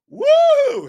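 high, loud answering cheer; cheering woo. Retold or imitated. Dad cheers back at the other mom, high loud whoop. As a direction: whoop right back at her. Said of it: "and I go".

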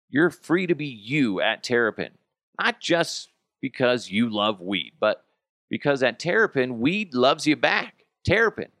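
upbeat sponsor read. Acted. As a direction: sell it like a radio spot. Reads sponsor ad.

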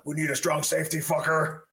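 high, rough tough-guy bark; gruff Flores impression. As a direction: growl it like an intense coach. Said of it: "And Flores is like".

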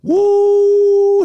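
high, loud whoop; excited whoop. Real, not acted. Cheering the coach's rough season, loud whoop. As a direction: let out a big whoo.

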